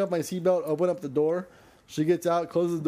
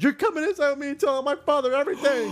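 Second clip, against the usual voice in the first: higher, louder, fast demand; mock demanding yell; 11 semitones higher, 4 dB louder, faster than usual. Girlfriend ordering him inside, a mock yell. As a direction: bark the order like the furious girlfriend.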